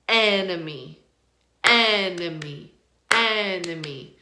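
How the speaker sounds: "enemy" with a drawn-out, strong EN; slow teacher's demo, EN-uh-mee for "enemy"; first-syllable stress. Shown as a demonstration. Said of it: "That first syllable is stressed".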